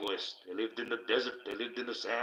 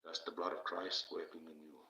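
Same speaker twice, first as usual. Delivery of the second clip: hushed; 4 semitones lower, 8 dB quieter than usual. Retold or imitated. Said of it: "That's when I say".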